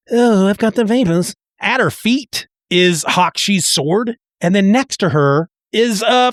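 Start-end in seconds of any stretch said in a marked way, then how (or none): 0.1-1.3 higher-pitched swooning voice; a case of the vapors, hammed up; mock fainting woman. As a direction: play up a faint spell. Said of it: "like she's got a case of the vapors".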